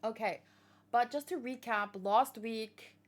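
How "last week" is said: normal talking voice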